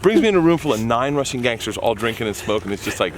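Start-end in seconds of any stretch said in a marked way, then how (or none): none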